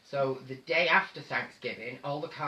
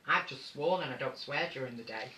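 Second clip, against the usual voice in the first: normal talking voice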